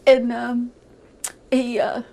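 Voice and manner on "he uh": ordinary talk, nothing marked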